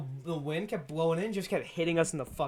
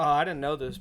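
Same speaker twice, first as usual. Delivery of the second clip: ordinary talk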